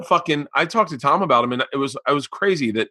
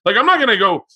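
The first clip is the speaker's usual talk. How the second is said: mock snitching retort; 6 semitones higher than usual. Acted betrayal retort.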